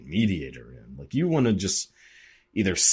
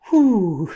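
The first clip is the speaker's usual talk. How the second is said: loud, high whistling "whew" of disbelief; stunned whew; 13 semitones higher, 9 dB louder than usual. Retold or imitated. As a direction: blow out a shocked whew. Shocked kid reacting to uncle.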